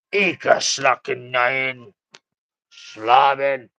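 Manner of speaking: слабый фальшивый немецкий акцент; hammy fake German accent. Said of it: "Fake fucking German".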